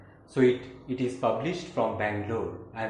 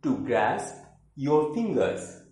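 emphatic declaimed verse with high phrase openings; theatrical poem reading with swooping intonation; poetry recitation; 5 semitones higher, 5 dB louder, slower than usual. Acted. Recites third poem, In Return.

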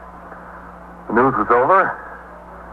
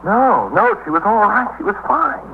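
high, rushed insistence; panicked denial; 13 semitones higher, slower than usual. Acted. Husband, asked about his wife's mood, insists in high panic. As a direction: deny it too quickly, voice climbing. Asked if wife was despondent.

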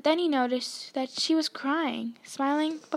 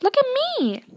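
much higher awed exclaim; amazed; 12 semitones higher, 5 dB louder than usual. A narrator's reading. Scene: Ron staring into the mirror, amazed. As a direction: gasp at what you see. Ron transfixed by his image.

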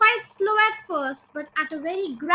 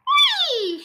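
very high, loud squeal; gleeful scream; 14 semitones higher, 6 dB louder than usual. A narrator's reading. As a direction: squeal with delight, falling away. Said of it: "she screamed".

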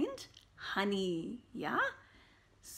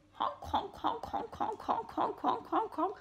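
high, nasal-free laughing honks; goose honks; 11 semitones higher than usual. Acted. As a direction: honk like a silly goose. Goose honking.

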